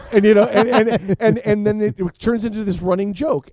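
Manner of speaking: can't hold back the laugh mid-sentence; laughing while talking. Real, not acted. Laughing while retelling the joke.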